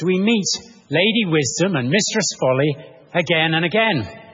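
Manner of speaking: ordinary talk, nothing marked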